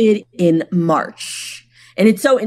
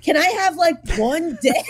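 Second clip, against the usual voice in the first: whiny mock plea; 10 semitones higher than usual. Complaining about rival posts.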